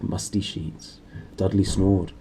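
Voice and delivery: plain reading voice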